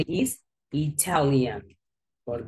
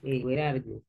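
ordinary talk

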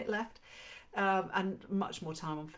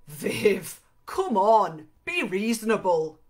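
coaxing and raised, a hint of cajoling; cajoling Irishman; 3 semitones higher, 12 dB louder, slower than usual. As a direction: plead with her to see reason, loudly. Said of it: "with a hint of cajoling".

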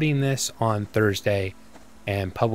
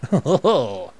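laugh; 4 semitones higher, 5 dB louder than usual. Real, not acted. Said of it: "talking like a French chef".

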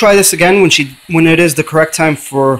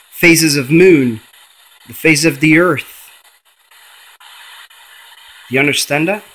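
normal talking voice

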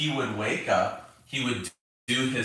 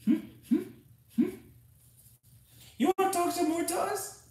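very high coaxing pet voice; faster than usual. Real, not acted. Coaxing the dog to talk.

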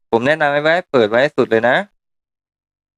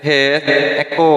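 echo effect; much slower than usual. Shown as a demonstration. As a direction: voice with heavy echo. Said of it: "ลูกหมุนใหญ่ๆนี้คือ Echo". (เสียงก้องเอคโค่)

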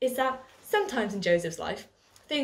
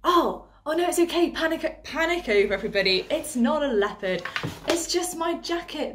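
loud, high startled relief; excited; 5 semitones higher, 5 dB louder than usual. Pretend. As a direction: blurt it out, then laugh off the scare. Pretend leopard scare relief.

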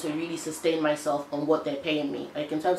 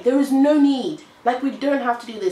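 exasperated; 6 semitones higher, 4 dB louder than usual. Retold or imitated. To her viewers, fed up with Nigerian red tape, exasperated and loud. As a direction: throw the words up in total exasperation. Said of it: "I'm just like".

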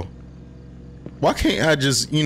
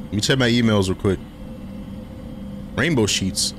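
ordinary talk, nothing marked

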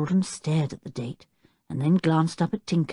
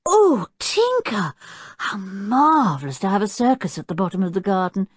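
higher and bright with delight; eyes shining; 5 semitones higher, 5 dB louder than usual. A narrator's reading. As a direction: sound thrilled by the idea. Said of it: "her eyes shining".

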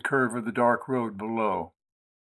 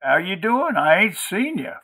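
high-pitched country woman's chat; folksy country woman; 6 semitones higher than usual. A narrator's reading. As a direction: greet a visitor in a high, folksy voice. Maudie chats in country voice.

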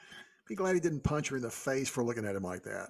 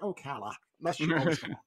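casual; 3 dB quieter than usual. Retold or imitated. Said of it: "it's just real casual".

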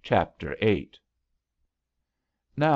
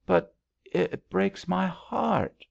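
heartbroken; 10 semitones higher, slower than usual. Acted. Billy weeping over lost love.

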